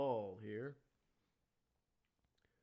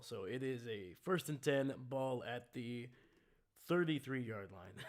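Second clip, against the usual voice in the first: ordinary talk, nothing marked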